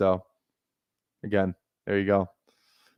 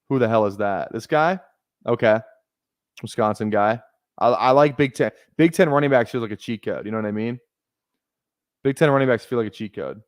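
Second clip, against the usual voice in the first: ordinary talk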